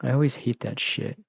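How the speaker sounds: usual speaking voice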